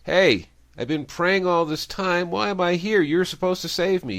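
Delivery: high, loud acted protest; aggrieved character challenging God; indignant complaint. Old man confronts God in heaven.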